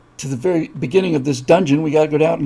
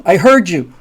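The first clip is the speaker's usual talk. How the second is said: loud retort to someone shouting; exasperated raised voice; 3 semitones higher, 7 dB louder than usual. Acted. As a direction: answer back loudly, fed up. Companion answers the shouting knight.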